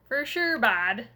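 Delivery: jokey Canadian hockey guy "for sure, bud"; Canadian hockey guy accent. Pretend. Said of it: "Canadian hockey guy accent".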